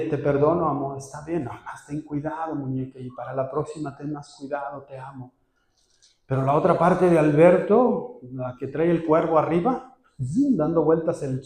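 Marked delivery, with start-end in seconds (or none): 0.0-5.3 soft, fading words of forgiveness; tender forgiveness. Acted. Husband softly forgiving his wife, tender and hushed. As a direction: forgive her gently, letting it fade.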